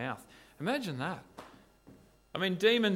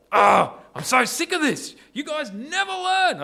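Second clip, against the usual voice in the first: loud, much higher, fed-up exasperated snap; exasperated outburst; 14 semitones higher, 12 dB louder than usual. Shown as a demonstration. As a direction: blow up like someone who has lost patience. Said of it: "I don't think that's the tone".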